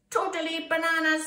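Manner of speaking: high, loud character squawk; exclaiming bat. A narrator's reading.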